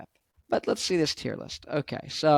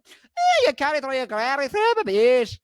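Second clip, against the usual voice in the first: very high, loud Scottish squawk; exaggerated Scottish accent; 10 semitones higher, 5 dB louder than usual. Vlogger mimicking relative in Scotland, hammed-up Scottish accent. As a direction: push the thick Scottish accent to a high pitch. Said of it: "I did all these accents".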